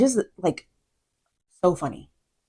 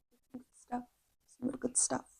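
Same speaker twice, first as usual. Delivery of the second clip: teasing whisper; 8 semitones higher, 17 dB quieter than usual. Real, not acted. Teasing repeat of praise.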